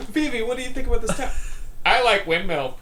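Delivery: high, fast, goofy voice; mock character voice. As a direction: ham up the silly dialogue. Mocking the game dialogue.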